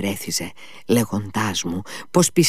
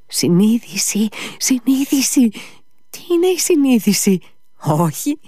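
high-pitched, agitated outpouring with sudden drops; feverish Ivan; 8 semitones higher than usual. A narrator's reading. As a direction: rant as tormented Ivan, pitch climbing high.

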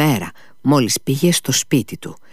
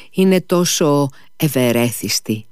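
ordinary delivery

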